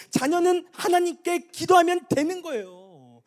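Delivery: high, loud, impassioned cries; full-force preaching. Real, not acted. Preacher urging children of God to tell Him, shouting.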